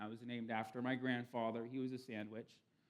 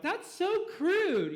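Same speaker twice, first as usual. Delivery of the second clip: high, slow, drawn-out disgust; disgusted girl; 8 dB louder, much slower than usual. Retold or imitated. Girl on the dating app shuts down a crude guy, high and slow. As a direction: stretch it out, appalled and prissy. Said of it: "And girls are like".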